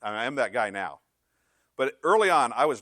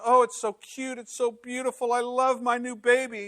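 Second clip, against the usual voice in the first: high-pitched, louder adoring coo; gushing; 12 semitones higher, 5 dB louder than usual. Acted. New parent gazes at their baby, gushing. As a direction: gush over the new baby.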